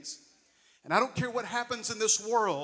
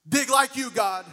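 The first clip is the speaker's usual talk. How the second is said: loud repeat of the shout, louder; echoing the yell; 4 semitones higher, 7 dB louder than usual. Retold or imitated. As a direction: call it out again to the congregation. Preacher echoes the yelled phrase.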